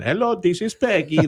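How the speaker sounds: a high, lightly put-on Russian accent; joking Russian scam-caller accent; mock Russian accent. Host mocking a Russian scam caller, light Russian accent. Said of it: "a Russian accent".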